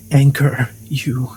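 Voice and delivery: normal talking voice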